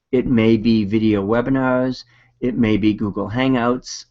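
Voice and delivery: ordinary talk, nothing marked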